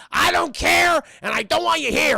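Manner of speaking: loud, high cartoon tirade; angry shout. Eustace rejecting old woman at door, angry shout. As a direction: shout the visitor away.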